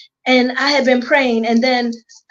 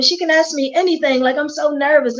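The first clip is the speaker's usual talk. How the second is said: ordinary talk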